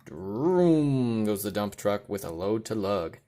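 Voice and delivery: usual speaking voice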